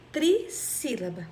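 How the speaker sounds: "trissílaba" with tri set apart from sílaba; syllable emphasis. Shown as a demonstration. Teacher naming a three-syllable word, "trissílaba" said in parts. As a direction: dwell on the prefix of "trissílaba". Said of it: "abri a minha boca três vezes".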